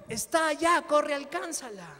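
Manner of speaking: preacher calling the son to run; excited urging. Retold or imitated. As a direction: shout him toward his mother.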